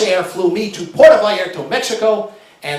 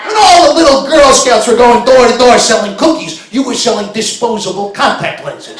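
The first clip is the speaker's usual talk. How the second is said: mocking rant; 4 semitones higher, 7 dB louder than usual. Mocking the clerk's childhood.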